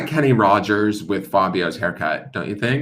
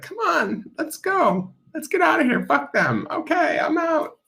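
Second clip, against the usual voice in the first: much higher, squeaky cartoon animal; high dolphin voice. Dolphin urging the others to leave, high squeaky voice. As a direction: chirp it like a cheeky dolphin leaving. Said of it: "It's like".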